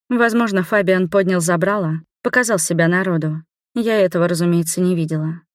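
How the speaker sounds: plain reading voice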